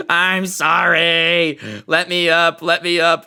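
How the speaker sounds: mock tearful pleading. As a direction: wail it like a scared little baby. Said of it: "bursts into tears".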